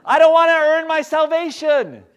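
high, loud mock protest; stubborn protest. Person in the pit objects to the rescuer, high stubborn holler.

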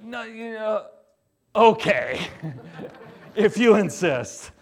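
laughing fake reluctance; mock relieved giving in. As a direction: give in with a laugh, secretly glad. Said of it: "she was Minnesota nice".

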